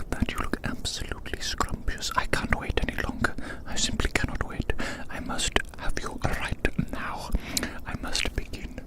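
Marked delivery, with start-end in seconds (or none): none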